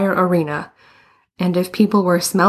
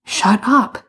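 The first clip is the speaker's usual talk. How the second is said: mild hissed shut-up, pitched higher; 4 semitones higher than usual. A narrator's reading. Boots to teasing Bruno, mildly hissed. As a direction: hiss it lightly, voice raised in pitch. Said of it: "Boots hissed".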